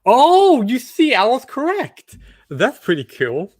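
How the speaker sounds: usual speaking voice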